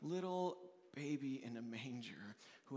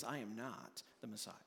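humble denial; 6 semitones lower, 11 dB quieter than usual. Acted.

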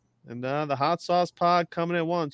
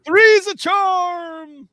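sing-song announcer; 6 dB louder, much slower than usual. Acted. Hosts announcing ranking segment.

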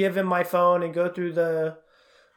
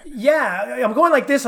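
ordinary talk

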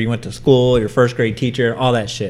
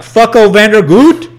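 louder and higher, calling the name out; intercom call; 9 semitones higher, 9 dB louder than usual. Retold or imitated. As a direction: announce the name over the intercom. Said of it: "and I'm like".

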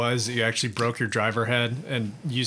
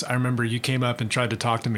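normal talking voice

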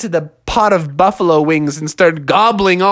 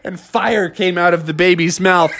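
ordinary delivery

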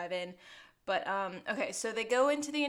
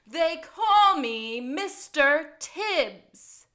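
loud, drawn-out dramatic declaration; movie quote; 5 semitones higher, 10 dB louder, much slower than usual. Acted. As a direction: belt it out slowly like a famous film line. Quoting a famous movie line.

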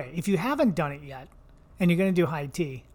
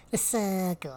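comic British pronunciation; funny British accent; slower than usual. Retold or imitated. Recalling the line she was told to say, British accent. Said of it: "your British accent's really funny too".

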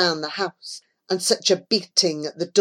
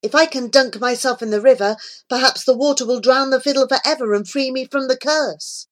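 quick, higher, hopeful rush; joyful thought; 6 semitones higher, faster than usual. A narrator's reading. Fiddling boy spotting the river, quick joyful hope. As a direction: race through it with eager hope. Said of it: "joyfully he thought".

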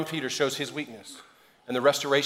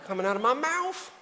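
quick, high-pitched comic cop voice, much higher on the second half; Chris Tucker impression; 5 semitones higher, 3 dB quieter than usual. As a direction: imitate a fast-talking action-movie cop. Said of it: "uh, Chris Tucker".

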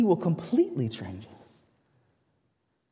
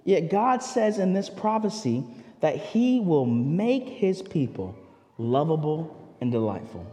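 ordinary delivery

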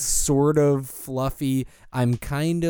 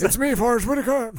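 high, quick cartoonish impression; hammed-up made-up accent, pitched up; Forrest Whitaker; 8 semitones higher, faster than usual. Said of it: "It's just him going like".

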